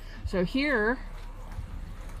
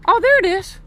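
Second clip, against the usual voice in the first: sudden high, loud exclamation on spotting it; surprised find; 12 semitones higher, 8 dB louder than usual. Real, not acted. Filming his park, spotting the caution tape, surprised. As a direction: blurt it out as it comes into view. Spots the thing he was seeking.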